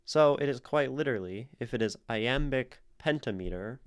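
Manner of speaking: ordinary talk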